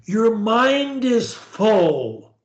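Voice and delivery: ordinary talk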